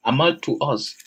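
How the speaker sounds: ordinary talk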